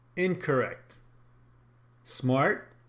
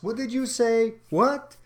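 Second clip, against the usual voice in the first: higher-pitched, puzzled "what?"; baffled asking; 8 semitones higher than usual. Acted. Trainer mimics someone who missed what was said, puzzled. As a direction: ask as if you missed it. Acts out informal questions.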